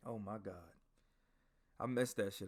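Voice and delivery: ordinary delivery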